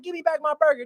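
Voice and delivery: high-pitched mock demand; imitated angry attitude. Vendor demanding his food back from a customer, imitated indignation. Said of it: "the attitude he had".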